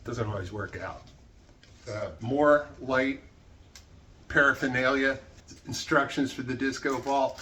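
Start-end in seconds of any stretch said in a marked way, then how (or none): none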